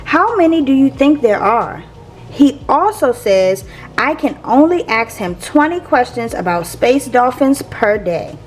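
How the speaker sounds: usual speaking voice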